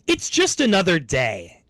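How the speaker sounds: slow, unmoved brush-off; cold dismissal. Retold or imitated. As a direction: shrug it off slowly, no comfort. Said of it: "in a not very warm or comforting way".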